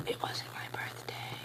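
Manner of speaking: slow secret whisper; whispered aside. Real, not acted. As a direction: confess it under your breath.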